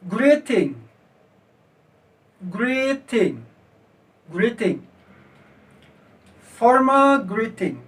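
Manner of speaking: "Greeting", "Formal greeting" read out slowly and separately for repetition; careful read-and-repeat drill, each phrase closed with a falling tune; pronunciation model. Shown as a demonstration. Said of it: "perhatikan cara bacanya".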